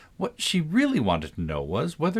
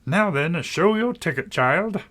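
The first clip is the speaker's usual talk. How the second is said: angry guard; 7 semitones higher, slower than usual. A narrator's reading. Guard angrily demanding Alice's ticket. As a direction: scold the child for her ticket. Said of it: "looking angrily at Alice".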